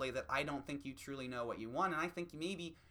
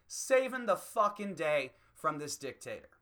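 usual speaking voice

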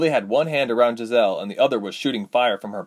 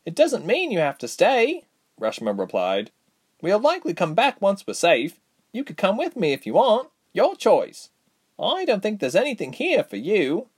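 put-on young heroine voice; 11 semitones higher than usual. Reshma to sister.

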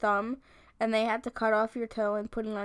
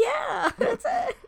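meme character voice; 12 semitones higher, 4 dB louder, much slower than usual. Retold or imitated.

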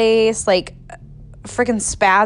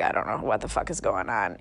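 lower, softer despair; quiet overwhelmed admission; muttered; 10 semitones lower, 9 dB quieter than usual. Retold or imitated. At the vet, lower and softer in despair.

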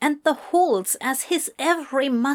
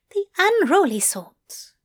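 much higher, playful angel voice; Aziraphale's voice; 9 semitones higher than usual. Acted. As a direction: pitch him up, smug and teasing.

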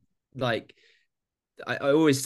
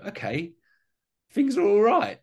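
relieved sigh of joy; 11 semitones higher, 6 dB louder than usual. Retold or imitated. Relieved inner voice after money worry.

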